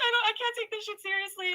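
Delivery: high voice through real laughter; too amused to keep it together; laughing hard. Real, not acted. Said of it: "I'm gonna break a rib laughing".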